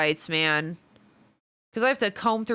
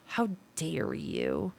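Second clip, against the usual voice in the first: mock offended mutter; 3 semitones lower, 8 dB quieter, slower than usual. Mock outrage, softer.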